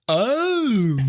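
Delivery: high, far-off little oh of a humble listener. Retold or imitated. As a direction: coo a meek high oh. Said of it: "My gateway to [name] is just".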